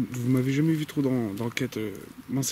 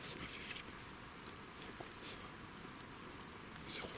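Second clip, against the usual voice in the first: startled breathless whisper; frightened whisper; 21 dB quieter than usual. Real, not acted. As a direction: whisper in sudden fear. Startled by noise in woods. (chuchotement apeuré)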